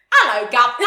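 cheesy British accent impression; mock British accent. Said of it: "Okay, so mine would be like".

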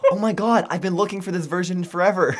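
very high, loud gushing; high-pitched, over-the-top delight; imitated excited teacher. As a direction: mimic an overjoyed teacher, laughing. Thrilled teacher quoted, laughing.

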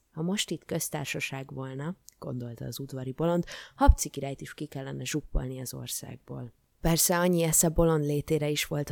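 ordinary delivery, nothing marked

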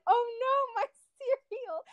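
high, teary wail through laughter; mock crying. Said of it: "I was just like".

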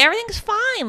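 high, suspiciously breezy mock reassurance; evasive reassurance. Wife dodging her husband's question, suspiciously reassuring. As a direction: imitate someone glossing things over, pitched well up. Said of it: "it's so suspicious".

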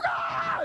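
very high mock shriek; hammed-up screech of agony; pained scream. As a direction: scream helplessly, pitch way up. Acts his own pained scream.

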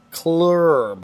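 "Club" drawn out as "clurrrb"; mispronounced club. Real, not acted. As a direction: stretch "Club" on a long r-coloured vowel. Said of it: "Second word".

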